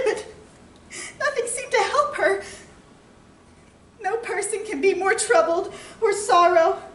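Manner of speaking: high, tearful outpouring; grief-stricken. Acted. Mother telling her husband their daughter died, grief-stricken.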